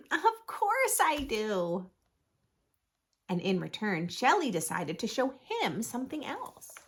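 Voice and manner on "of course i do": higher, slow, drawn-out reassurance; emphatic, affectionate yes; reassuring friend. Acted. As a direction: stretch it out to comfort a worried friend.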